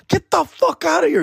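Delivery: disbelief. Retold or imitated. Disbelief at free bands.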